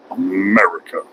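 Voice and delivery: America. dropped low with a falling end; low falling echo. Acted. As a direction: let America. fall low like an answer. Answers own call lower.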